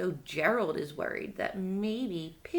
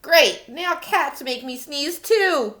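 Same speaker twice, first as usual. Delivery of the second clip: exasperated; 7 dB louder than usual. Acted. [name] exasperated reading.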